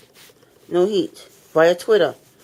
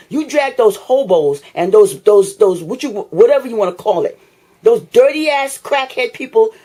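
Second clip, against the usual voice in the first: louder, heated ranting; real fed-up tenant yelling at the housing authority; angry rant; 6 dB louder than usual. Real, not acted. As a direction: let the frustration boil over.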